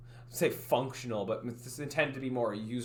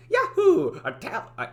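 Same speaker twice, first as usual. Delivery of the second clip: 1 Italian accent attempt; 13 semitones higher, 10 dB louder than usual. Shown as a demonstration. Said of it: "the Italian accent".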